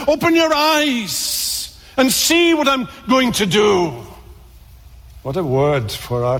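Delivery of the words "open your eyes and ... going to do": high pleading call. Acted. God pleading with wandering children.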